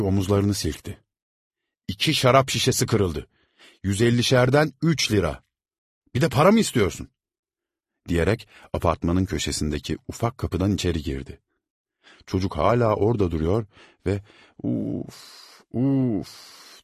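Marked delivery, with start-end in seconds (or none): 1.9-7.1 loud, high scornful reply; scornful scolding. A narrator's reading. Servant scornfully refusing to pay the boy. Servant mocks boy's demand. 14.6-16.8 crying. A narrator's reading. Boy crying in pain. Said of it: "diye ağlıyordu".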